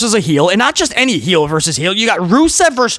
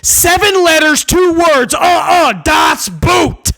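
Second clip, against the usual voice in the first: mock shout; 8 semitones higher, slower than usual. Real, not acted. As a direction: shout the joke out big.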